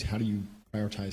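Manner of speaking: ordinary delivery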